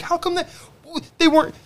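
high-pitched mock questioning voice; mocking quote of a skeptic. Mocking the friend's doubting question, high mock voice. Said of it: "So when you're like".

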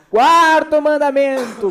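grand announcement. Acted. Announcing the fourth commandment.

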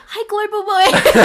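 loud, high, drawn-out pretend hello; mock greeting. Imagining herself greeting her crush, laughing mock call. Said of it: "Imagine me going".

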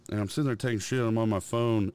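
ordinary delivery

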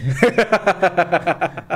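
high giggle burst; giggling laugh. Real, not acted. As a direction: giggle at yourself. Giggling at twitch.

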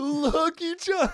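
high laughing mimicry, slowed. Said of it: "repeats the impression laughing".

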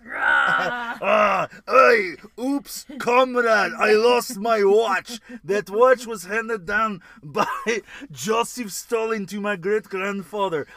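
loud, slow hammed Russian accent. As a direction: boom it out as a Russian comrade. Mock Russian comrade bit.

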